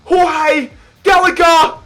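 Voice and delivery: high, stretched goal call; goal celebration shout. Real, not acted. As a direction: yell the name out.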